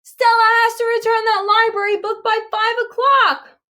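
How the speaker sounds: high, loud shouted plea; shouting. A narrator's reading. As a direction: call out after the runner. Duff shouting after Miss Flynn.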